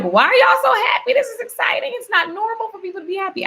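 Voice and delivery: high, squealing disbelief at cheerful staff; playful high-pitched astonishment; mock amazement. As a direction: act baffled by their happiness. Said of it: "I'm like".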